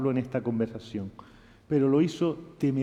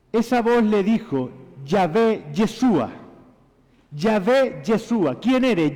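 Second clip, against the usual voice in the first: higher, louder, driving sermon; fervent preaching; 4 semitones higher, 3 dB louder than usual. Acted. Pastor preaching to the congregation that Jesus is God, fervent.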